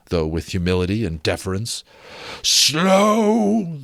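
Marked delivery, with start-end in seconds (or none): 2.4-3.8 high, loud, long drawn-out Slow; ghost repeating. Acted. Ghost echoing Scrooge's word, drawn-out wailing Slow. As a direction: stretch Slow into a wail. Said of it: "the ghost repeated".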